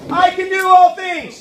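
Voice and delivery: "I can do all things." called out for the crowd; out loud. Shown as a demonstration. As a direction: lead the kids in shouting it. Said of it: "let's do it again".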